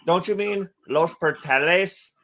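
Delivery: ordinary talk, nothing marked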